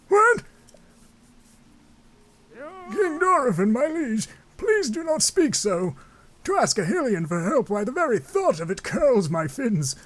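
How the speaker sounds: very high, squawking protest voice; indignant courtier. Acted. Muzu protests to the king, high indignant voice.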